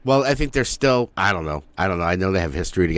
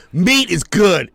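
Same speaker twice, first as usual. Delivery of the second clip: mock dumb declaration; 9 semitones higher, 4 dB louder, slower than usual. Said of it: "he goes".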